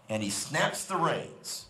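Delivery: usual speaking voice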